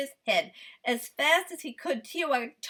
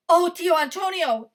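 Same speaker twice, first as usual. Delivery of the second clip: higher, louder gasp; gasped plea; 6 semitones higher, 9 dB louder than usual. A narrator's reading. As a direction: plead breathlessly. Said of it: "he gasped".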